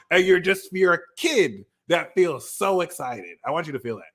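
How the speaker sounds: normal talking voice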